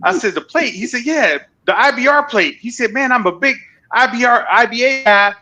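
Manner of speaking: high, eager stranger gushing; excited fan voice. Retold or imitated. Stranger at a gas station gushing at a biker, high and excited.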